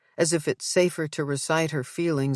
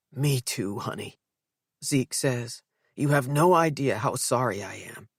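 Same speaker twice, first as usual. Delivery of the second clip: tender father; 3 semitones lower than usual. Acted. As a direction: hush it tenderly to his daughter. Father to daughter.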